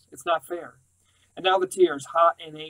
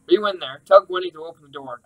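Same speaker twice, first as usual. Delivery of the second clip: louder, insistent through the door; demanding call; 3 semitones higher, 7 dB louder than usual. Acted. Uncle shouting through the locked door, insistent.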